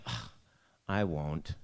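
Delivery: put-on low, grudging teenage grumble; groan, then refuse as a put-on sulk; sulky teen. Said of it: "pretend I didn't like stuff".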